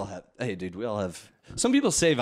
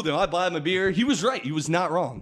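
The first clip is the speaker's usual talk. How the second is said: ordinary talk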